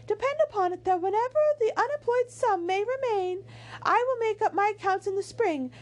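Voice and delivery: very high-pitched lady's voice; Missus Dashwood. A narrator's reading. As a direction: put on a high, fluty mother voice, held right through. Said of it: "this is supposed to be Missus Dashwood".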